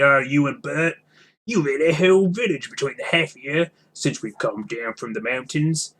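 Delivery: lightly voiced sulky troll; grumbling troll. William's troll voice.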